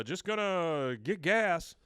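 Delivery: slow, loud, high drawl; lightly voiced Texan dad; Hank voice. Hank's sneaky excuse.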